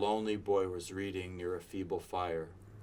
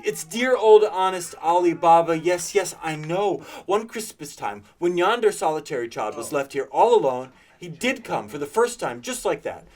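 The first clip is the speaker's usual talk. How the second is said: loud, high, eager reminiscing; ecstatic; 6 semitones higher, 9 dB louder than usual. A narrator's reading. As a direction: gush over a childhood hero. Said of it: "Scrooge exclaimed in ecstasy".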